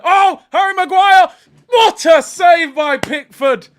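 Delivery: high, loud, slow excited call; commentator shout. Pretend. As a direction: yell it like a TV commentator at a big save. Mock commentator shout at save.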